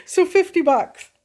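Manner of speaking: high, loud and delighted; real glee bubbling up over fifty bucks; telling friends about the windfall, thrilled. Real, not acted. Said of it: "So I was just thrilled".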